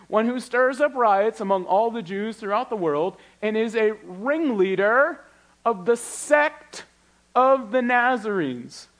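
slow, high, ringing Bible reading; preacher reading out the charge against Paul, slow and lifted; dramatic scripture reading. Acted. Pastor voicing Tertullus's charge to the congregation, slow and lifted.

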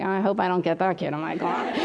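imitating a wary onlooker's inner voice; mock dread. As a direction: dread it privately, under your breath. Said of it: "the look he gave me, like".

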